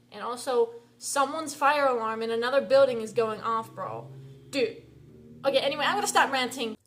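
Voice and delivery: vent, then cut yourself off; loud ranting. Real, not acted. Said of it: "yelling anymore on camera".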